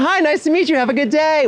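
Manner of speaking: mock cashier's sing-song goodbye; chirpy retail clerk. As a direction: play a perky cashier brushing off a customer.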